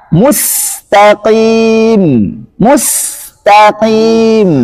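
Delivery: very slow "Mustaqim" with a thin clear s. Shown as a demonstration. Teacher models the clear sin. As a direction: stretch "Mustaqim", s light not emphatic. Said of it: "Harus jelas".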